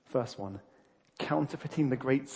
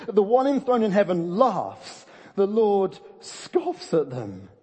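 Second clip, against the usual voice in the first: loud, slow verse reading with drawn-out key words; slow, raised-voice proclamation; emphatic reading; 4 semitones higher, 5 dB louder, slower than usual. Acted. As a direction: declaim the scripture, leaning on each verb.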